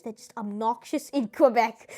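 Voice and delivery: ordinary talk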